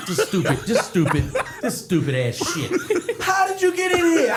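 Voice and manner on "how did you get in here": higher, louder hammed-up shout; mock yell. Retelling his fake outrage at the ex, loud and higher. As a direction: bellow the question in mock outrage.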